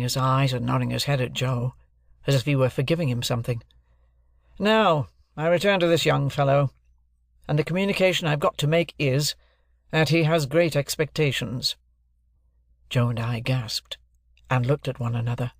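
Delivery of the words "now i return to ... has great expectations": raised, deliberate lawyer's voice; stern lawyer. Acted. Jaggers introducing himself to Joe and Pip, stern lawyer. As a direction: announce yourself like a cool London lawyer.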